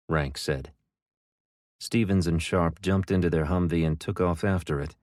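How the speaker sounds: ordinary delivery, nothing marked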